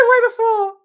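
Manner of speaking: high, loud, stunned question; shocked. Real, not acted. Reader realizing the Fool's meaning, high and loud. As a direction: blurt it out in disbelief.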